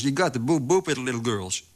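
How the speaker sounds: playful, lilting crowing; jubilant cheering. A narrator's reading. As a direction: tease him gleefully in hipster English. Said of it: "juichte Gonzales". (juichend en speels)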